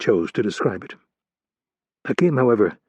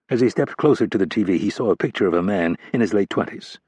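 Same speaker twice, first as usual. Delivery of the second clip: ordinary delivery, nothing marked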